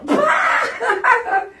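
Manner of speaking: loud, high-pitched mock guffaw; imitated burst of laughter. Other inmates laughing at the lieutenant, imitated outburst. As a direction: explode like a crowd cracking up. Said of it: "they were like".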